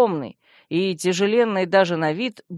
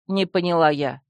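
ordinary delivery, nothing marked

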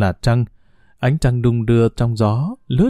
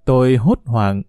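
usual speaking voice

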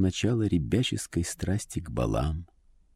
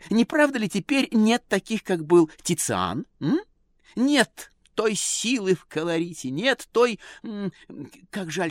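high, affected chattering lady; society lady voice; 12 semitones higher than usual. A narrator's reading. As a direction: prattle like a fussy socialite. Lady chatters about portraitists.

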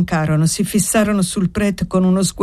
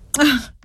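a sharp, harsh "Ah!" pitched much higher; harsh cry; 9 semitones higher than usual. A narrator's reading. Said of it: "gridò allora Annessa con voce aspra". (grido aspro e acuto)

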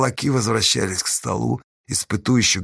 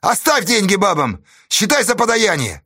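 high, scornful command; angry shout; 9 semitones higher than usual. A narrator's reading. Kornila telling them to leave the money, angry shout. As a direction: order it angrily. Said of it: "гневно крикнул Корнила Нелюбин".

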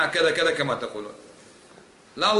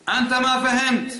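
higher, louder and slower, mocking the opponent with "أنت ما فهمت"; mock retort; 10 semitones higher, 5 dB louder, slower than usual. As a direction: drag out "أنت ما فهمت" in a high, scornful voice. (تقليد ساخر بصوت أعلى وأبطأ لـ"أنت ما فهمت")

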